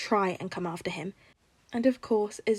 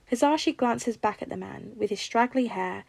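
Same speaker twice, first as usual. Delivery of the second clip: plain reading voice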